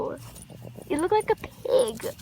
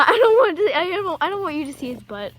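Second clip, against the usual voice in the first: ordinary talk, nothing marked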